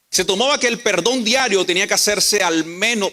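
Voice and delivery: usual speaking voice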